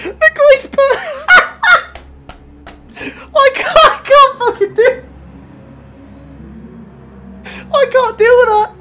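shrieking with tearful laughter, high and loud; hysterical laughing. Real, not acted. Streamer cracking up at a rude driver name, shrieking laughter. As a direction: laugh till you cry, squeaking out the words. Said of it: "I've already got tears in my".

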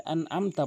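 আনআমতা with plain vowel for ain; quick light আনআমতা; common mispronunciation. Shown as a demonstration. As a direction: say আনআমতা with no throat squeeze. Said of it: "আনআমতা বলে".

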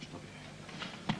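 whispered slowly under his breath. Acted. Patient whispering the doctor's verdict to himself, slow and hushed. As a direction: let it sink in, barely voiced, very slowly.

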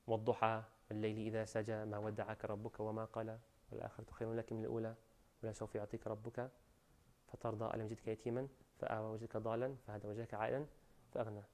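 rapid soft recitation of the verses; تلاوة سريعة هادئة; quick Quran recitation. Acted. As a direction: rush through the surah under the breath.

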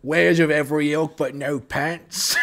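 loud, slowed mock British merchant; British accent. Quoting the merchant Monty, loud mock British accent. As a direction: play up a posh British shopkeeper. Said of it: "The British accent, uh, I'm sorry, the accent that I attempted there".

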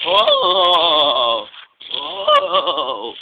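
long, loud sliding ohs; loud moaning "oh". Acted. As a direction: wail in hammed-up astonishment. Diesel's astonished cry in story.

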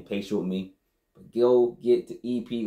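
normal talking voice

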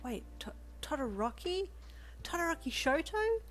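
high, rising incredulous question; starstruck disbelief. A narrator's reading. You learn who shares the table, starstruck disbelief. Starstruck fan hears a hero's name.

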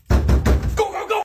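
high, loud shout to run; frantic, high-pitched shouting; urgent yell. Acted. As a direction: yell for your buddies to bolt. Kids fleeing after the prank.

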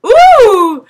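loud rising ooh; delighted ooh. Real, not acted. Kid hears about teleporting, delighted ooh. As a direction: gasp with delight.

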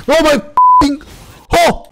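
high, loud startled swear; scared out of his skin; jump-scared cry. Real, not acted.

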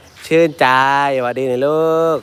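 gentle coaxing voice to an elephant. Shown as a demonstration. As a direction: coax the elephant kindly. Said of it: "ทำเสียงค่อย".

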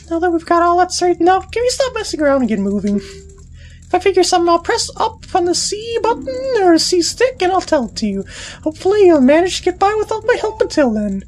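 high, indignant fairy scolding; bossy fairy. Acted. Tatl blaming Deku boy for losing her brother, high and indignant. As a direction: snap at him like a bratty little fairy.

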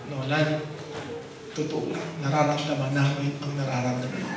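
Father Joey softly consoling Nolan, whispered; whispered sympathy. Retold or imitated. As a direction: hush it like consoling a mourner. Father Joey consoling him quietly.

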